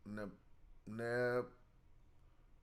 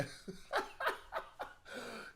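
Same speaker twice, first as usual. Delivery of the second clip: breathy, unvoiced laugh at his own joke; real laugh; 9 semitones higher, 9 dB quieter than usual. Real, not acted.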